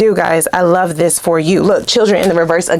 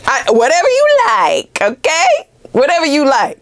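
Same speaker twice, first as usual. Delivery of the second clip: Coming to America bit; 7 semitones higher than usual. Pretend. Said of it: "Going all Coming to America on us".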